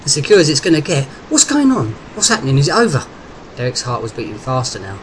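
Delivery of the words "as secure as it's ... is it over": loud, high, tumbling questions; agitated, urgent. A narrator's reading. Derek to the major on the phone, urgently pleading for news. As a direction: fire off questions, desperate for news.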